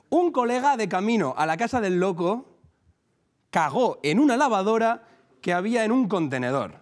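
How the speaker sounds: anécdota leída con énfasis, más aguda; lectura cómica en público. Acted. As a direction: proclama la anécdota por partes, con pausas.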